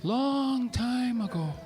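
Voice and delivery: slow, drawn-out storyteller opening. Shown as a demonstration. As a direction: stretch it like an old storyteller. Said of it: "I gotta sound like an authentic storyteller".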